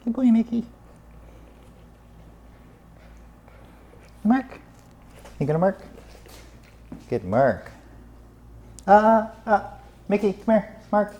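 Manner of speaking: high, coaxing call to the dog. Real, not acted. Trainer calling Mickey to mark, high and coaxing. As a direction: coax the dog over in a high voice.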